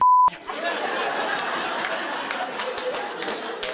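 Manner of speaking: breathless high cackle; high wheezing laugh. Real, not acted. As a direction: burst into airless laughter. Comedian's big laugh.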